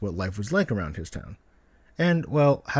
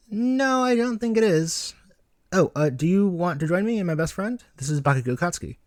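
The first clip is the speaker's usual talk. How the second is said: put-on high eager boy's voice; young Izuku, eager; 7 semitones higher than usual. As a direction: pitch up as an earnest little boy.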